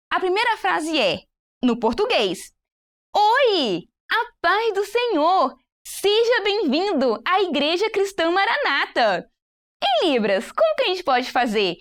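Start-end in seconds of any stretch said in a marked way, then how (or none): 3.1-9.3 high, slow, welcoming greeting; animated welcome. Shown as a demonstration. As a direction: welcome a visitor with joy. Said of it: "Irmãos, com animação". (recepção animada e aguda)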